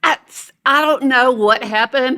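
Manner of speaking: ordinary delivery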